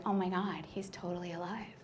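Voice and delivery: hushed, stunned whisper; shocked whisper. Acted. Whispering to herself that her ex is alive. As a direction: whisper it like you can't believe it.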